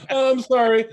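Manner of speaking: nervous creep. Retold or imitated. Friend teases the follower's apology, lightly mocked nervous creep. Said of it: "the most nervous creep".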